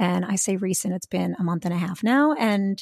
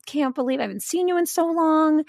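much higher, bright happy hello; mock cheerful ex in a dream; ex boyfriend's cheerful greeting; 9 semitones higher than usual. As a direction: greet her like he's thrilled to see her. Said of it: "he was like".

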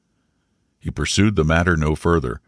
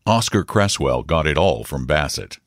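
ordinary delivery

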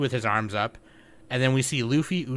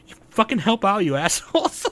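higher, mock-angry shout from afar; Zoro yelling from background; 10 semitones higher, 3 dB louder than usual. Zoro scolding the crew mid-gag, mock shout. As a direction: snap at the crew from the back. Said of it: "Zoro's in the background going".

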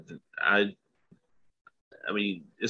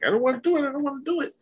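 very high, panicked imitation of Mark; frantic repeat; much faster than usual. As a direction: wail it like he's losing control. Mark losing control, retold.

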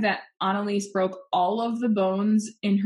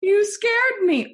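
put-on high, slow scared little girl voice; squeaky, frightened child voice; 12 semitones higher, 5 dB louder, slower than usual. Repeats scared girl imitation.

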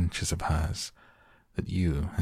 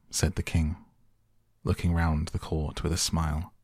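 plain reading voice

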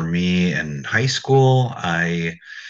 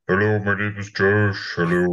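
lowest voice. Retold or imitated. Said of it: "I talked in my lowest voice".

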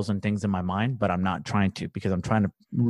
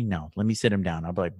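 ordinary delivery

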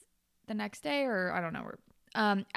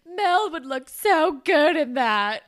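loud, high, drawn-out swooning; mock stoned enthusiasm; 6 semitones higher, 10 dB louder, slower than usual. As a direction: blurt it out slowly, hammed-up and loud. Acting stoned friend's lovesick exclamation.

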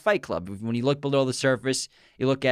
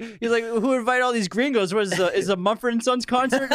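mock Mexican drug dealer; 10 semitones higher than usual. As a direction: ham up the drug dealer bit. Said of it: "he's pretending to be, like, a Mexican drug dealer".

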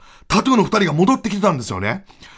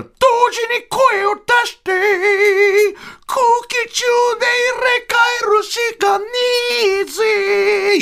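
mock [name]; 13 semitones higher than usual. The rock star explaining the voice swap, hammed up. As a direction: lean into the swagger.

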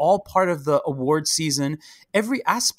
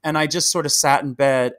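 ordinary talk, nothing marked

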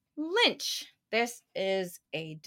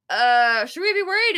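higher, louder mock-anxious question; retold worry; 4 semitones higher, 7 dB louder than usual. Asking locals about the windy weather, retold with mock worry.

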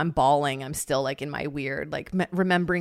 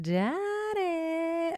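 swooning; 11 semitones higher, 5 dB quieter than usual. Retold or imitated. Swooning over the hot instructor.

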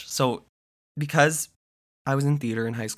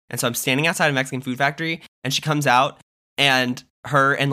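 ordinary talk, nothing marked

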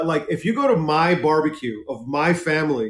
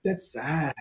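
put-on soft Chicano-accented drawl; Chicano catchphrase; 8 dB quieter than usual. Repeating the sketch's catch line, soft Chicano accent. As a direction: say it quietly with a Chicano lilt. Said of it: "because the catch line was".

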